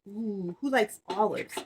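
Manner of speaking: plain reading voice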